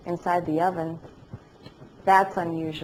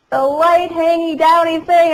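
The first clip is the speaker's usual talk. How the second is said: slow, much higher and louder through laughter; laughing; 11 semitones higher, 11 dB louder than usual. Real, not acted. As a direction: savour the phrase while laughing. Repeating husband's phrase, amused.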